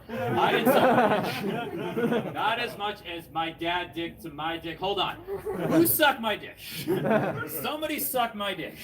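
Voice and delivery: high, loud, cracking up at the mistake; laughing fluster. Real, not acted. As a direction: lose it laughing at your own slip. Comic flustered over botched line.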